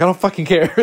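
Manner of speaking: angry snap. Retold or imitated. Tense fan snapping at the room, angry. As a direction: snap at them, fed up. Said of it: "I'm like".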